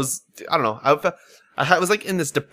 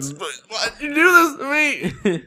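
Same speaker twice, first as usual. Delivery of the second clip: very high, slow teary whine; mock weepy whine; 14 semitones higher, slower than usual. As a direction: whimper it at the movie. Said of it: "I was like".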